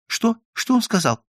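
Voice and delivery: high-pitched, anxious gossiping voice; timid chatterer. A narrator's reading. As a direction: pester them nervously in a high little voice. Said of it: "боязливый Иоанна Польский, беспрерывно шепча".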